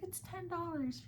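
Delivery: sweet high reply. Retold or imitated. Her own reply, high and slow.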